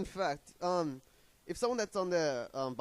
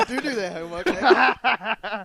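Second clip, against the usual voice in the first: mocking; 4 semitones higher, 14 dB louder, much slower than usual. Mocking the "do do" slip.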